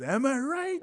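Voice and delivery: high-pitched catchphrase; mock catchphrase delivery; imitated friend's teasing. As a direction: toss the hook line out high. Friend throwing his catchphrase at him.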